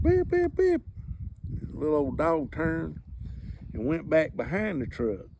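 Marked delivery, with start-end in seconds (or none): 0.0-0.8 high, clipped beeps of a car horn. Retold or imitated. Driver honking at a dog, imitated horn beeps. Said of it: "and I hit the horn".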